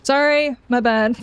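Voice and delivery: ordinary delivery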